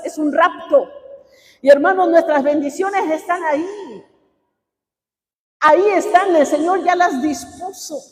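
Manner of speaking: rising sermon fervor; fiery preaching. Acted. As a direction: preach it at full force to the congregation. Preacher still at full force.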